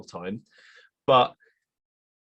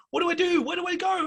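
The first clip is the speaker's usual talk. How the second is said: very high panicked pleading; freaking out. Retold or imitated. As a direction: beg for help in a shrill panic. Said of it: "while she's freaking out".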